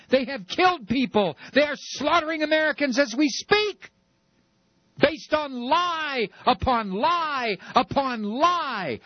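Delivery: high, heated rant, slowing at the end; impassioned outrage. Real, not acted. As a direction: pour out the outrage about the lies. Host's impassioned rant about the war.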